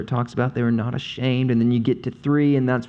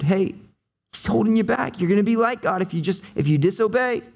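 higher, pushy serpent; tempting serpent; 8 semitones higher, faster than usual. Retold or imitated. Serpent urging the woman to disobey, higher and pushier. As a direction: press her fast, scoffing at God.

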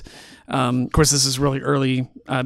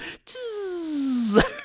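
high laugh; 13 semitones higher, 7 dB quieter than usual. Real, not acted. Laughing at blob scene.

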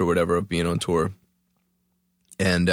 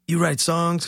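stranger's voice; 8 semitones higher, slower than usual. Retold or imitated. Retold encounter, stranger's voice.

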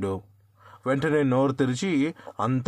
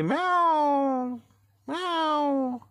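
very high, drawn-out cat yowl; fierce meow; much slower than usual. A narrator's reading. Cat leaping at the roosters, with a high drawn-out yowl. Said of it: "భయంకరంగా అరుస్తూ".

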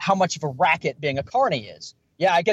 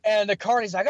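ordinary talk

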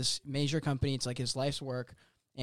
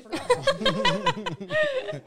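laughing; 3 dB louder than usual. Real, not acted. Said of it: "laughing while acting".

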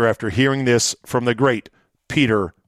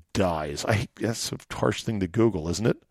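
quiet, sniffly voice with a cold; sniffling; 4 semitones lower, 7 dB quieter than usual. Real, not acted. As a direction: talk through a runny nose. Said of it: "Excuse my sniffling".

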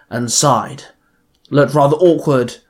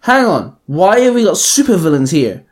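higher, louder indignant bark; 4 semitones higher, 4 dB louder than usual. Acted. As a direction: bark the question at the room. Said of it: "Robin barked".

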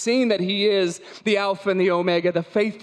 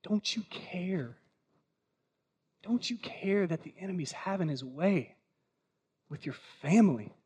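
tender plea; 6 dB quieter than usual. Acted. Jesus voiced pleading softly.

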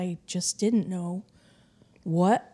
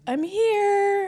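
calling back; 13 semitones higher than usual. Retold or imitated. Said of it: "And I'm like".

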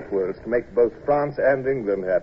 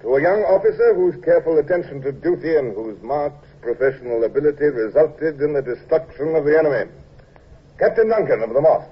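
higher, louder speech voice; formal toast; 3 semitones higher, 4 dB louder than usual. Acted. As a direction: raise a glass and speak out.

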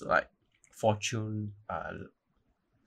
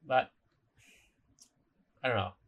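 ordinary talk, nothing marked

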